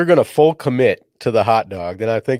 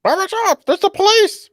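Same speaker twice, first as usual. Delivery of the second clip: very high squawking parrot cry; 3 dB louder than usual. Pretend. Lookout parrot on a pirate's shoulder warning the crew, in a very high squawk. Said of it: "The bird's the lookout".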